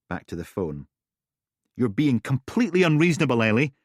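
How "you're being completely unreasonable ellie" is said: loud, fast frustrated; exasperated. Acted. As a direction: push back hard. Phone argument.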